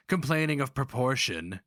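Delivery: ordinary delivery, nothing marked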